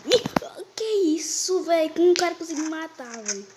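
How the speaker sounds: high, loud disbelief; can't believe he got killed; shocked outrage. Real, not acted. Sudden death in game.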